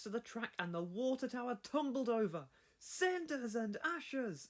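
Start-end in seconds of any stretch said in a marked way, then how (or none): 2.8-4.5 high, slow shocked cry; exclaimed. Acted. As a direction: cry out in dismay, high and drawn out. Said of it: "exclaimed Thomas".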